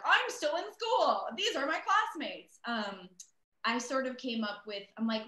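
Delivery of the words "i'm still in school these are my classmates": high, loud, drawn-out character voice; hammed-up high-pitched impression. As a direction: blurt an excuse in a much higher voice. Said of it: "like, Bo's like".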